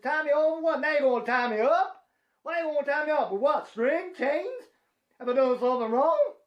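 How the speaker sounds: high, rising, panicky questions; a hammed-up frightened protest; mock panic.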